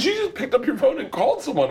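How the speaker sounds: very high, incredulous friend impression; mock outrage. Friend accusing the storyteller of phoning the secret around, mock outrage. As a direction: imitate him scolding, voice way up high.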